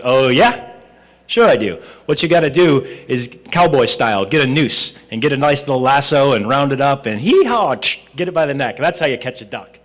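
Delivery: loud, cocky cowboy bragging; boastful vagrant. Acted. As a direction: brag like you know it all. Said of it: "the guy goes".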